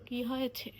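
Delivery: gentle coaxing whisper. Real, not acted. Parent whispering to her sulking daughter Sara, soft coaxing whisper.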